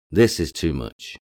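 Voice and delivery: usual speaking voice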